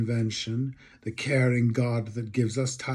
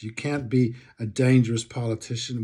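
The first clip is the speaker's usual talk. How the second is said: normal talking voice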